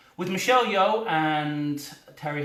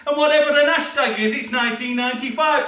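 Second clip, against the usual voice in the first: excited rant; 6 semitones higher, 7 dB louder, faster than usual. Pretend. Excited fast hashtag aside.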